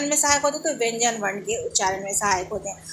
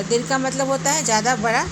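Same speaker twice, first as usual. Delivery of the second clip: ordinary talk, nothing marked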